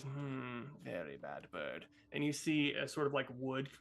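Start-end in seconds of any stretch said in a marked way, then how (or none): 0.0-1.9 lower, softer, menacing witch; low witch scold. Acted. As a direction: drop the voice and scold the bird.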